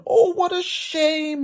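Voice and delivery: high, slow, tender farm-wife voice; a motherly woman cooing over a kitten; concerned farm wife. Acted. Farmer's wife voiced in story.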